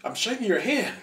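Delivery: high, loud starstruck gush; awestruck fanboy. Retold or imitated. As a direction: stammer out your awe to your hero.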